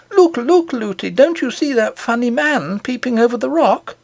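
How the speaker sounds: put-on high, eager child calling look; an excited little girl pointing things out. A narrator's reading. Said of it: "She was always saying".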